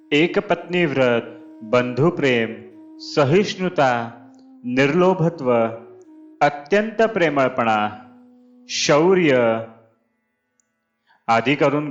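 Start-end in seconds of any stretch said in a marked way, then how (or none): none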